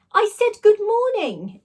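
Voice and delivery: high, loud and slow; posh booming repeat. Acted. Slime blob insisting, posh and booming. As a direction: repeat it grandly, like royalty. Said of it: "it repeated".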